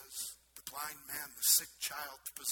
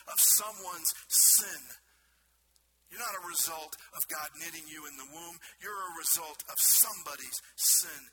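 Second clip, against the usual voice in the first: impassioned preaching; 3 semitones higher, 8 dB louder than usual. Acted. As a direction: hammer the accusation home from the pulpit. Preacher rising to full force.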